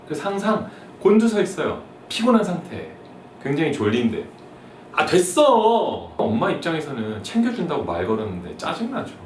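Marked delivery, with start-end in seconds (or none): 4.9-6.1 irritated son. Acted. Son brushing off mom.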